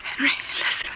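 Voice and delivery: soft, breathy warning whisper; scared hushed warning; urgent whisper. Acted. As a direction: whisper it close to his ear. Hiding from the children.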